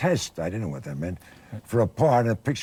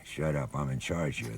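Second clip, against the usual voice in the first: "Shut up, I'm in charge here" half-whispered low and soft; hushed, copying Tracy's undertone; sotto voce; 6 semitones lower, 7 dB quieter than usual. Retold or imitated. Actor repeating his line softly on set, in sotto voce. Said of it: "So when he sotto voce'd me".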